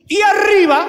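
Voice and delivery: high, loud exclamation; shout. Acted. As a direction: burst out with it. Preacher's sudden exclamation. (exclamación fuerte y aguda)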